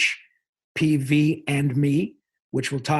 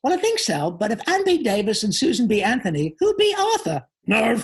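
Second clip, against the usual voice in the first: high, excitable cartoon-mouse voice; Pinky; 7 semitones higher, 4 dB louder than usual. Actor gives a second pondering joke as Pinky, high cartoon voice. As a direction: do a zany high cartoon sidekick, leaping up at the punchline. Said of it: "here's another one. Ask me again".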